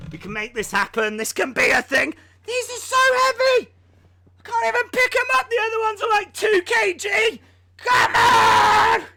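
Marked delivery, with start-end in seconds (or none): 2.5-9.0 high, strained effortful voice; straining. Real, not acted. Streamer strains lifting heavy weights. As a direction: squeeze the words out under the weight.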